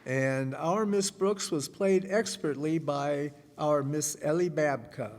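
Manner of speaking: ordinary delivery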